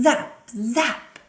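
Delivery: high, loud, zappy; big sound word. Shown as a demonstration. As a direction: snap out each zap like lightning. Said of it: "these words are bigger here".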